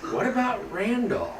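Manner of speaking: lightly voiced small child, quiet and high; a little one asking softly from the back. A narrator's reading. A small reindeer calf asking the elders in a small voice. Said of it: "one small voice would ask".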